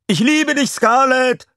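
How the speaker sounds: very high, loud fan shout; adoring fan scream. A narrator's reading. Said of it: "manche schrien".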